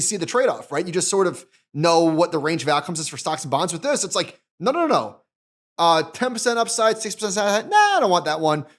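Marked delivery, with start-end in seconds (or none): 4.6-8.6 lightly voiced investor, pitched higher, picking options; imagined eager investor. Investor browsing buffer ETF choices, voiced light and higher. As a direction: play a buyer shopping for outcomes, a bit higher.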